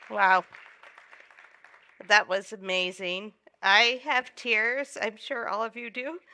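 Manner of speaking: usual speaking voice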